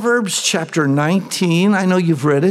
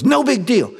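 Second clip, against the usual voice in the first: mock dismissive; 10 semitones higher than usual. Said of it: "Everybody goes".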